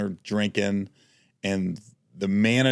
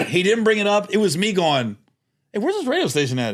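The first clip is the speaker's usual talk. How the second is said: excited telling; 10 semitones higher, 4 dB louder than usual. Real, not acted. Building up the funny story.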